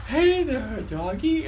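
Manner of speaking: put-on high sing-song baby talk to a dog; high cooing to a dog. Roommate cooing at the service dog, high and sweet. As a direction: coo at the doggy in a high voice. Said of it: "He's like".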